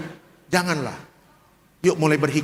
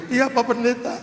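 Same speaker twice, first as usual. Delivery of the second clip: imitating widow; 7 semitones higher than usual. Widow replies.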